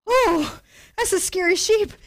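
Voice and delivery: playful alarmed squeal; startled. Retold or imitated. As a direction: gasp it out like you just got a fright. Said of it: "I'm like".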